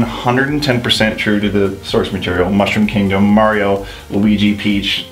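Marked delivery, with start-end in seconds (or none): none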